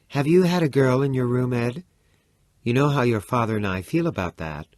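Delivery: ordinary delivery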